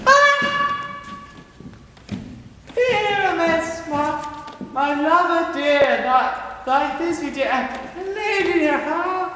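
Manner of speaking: high, slow squeaky lady voice; monstrous little voice. Acted. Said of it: "I will speak in a monstrous little voice".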